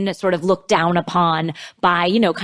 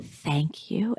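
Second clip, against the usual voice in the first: much softer, near-whisper; retold whisper, barely there; whispered thanks; 3 semitones lower, 10 dB quieter than usual. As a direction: imitate a hushed, tearful-actress thank you. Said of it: "She whispers".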